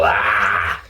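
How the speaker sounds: ordinary talk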